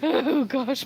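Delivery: ordinary delivery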